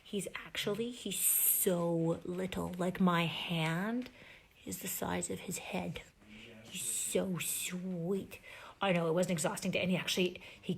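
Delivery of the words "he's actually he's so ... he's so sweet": very slow, soft, tender; tender hush. Real, not acted. Showing the tiny puppy to viewers, slow tender hush. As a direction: stretch each word gently, hushed. Hushed beside the sleeping puppy.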